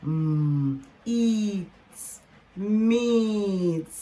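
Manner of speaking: hummed "mm", long "eat", then "meat"; blending sounds. Shown as a demonstration. Teacher blending the next word for learners, sounded out. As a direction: hold each part, then stretch out "meat". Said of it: "let's blend them together".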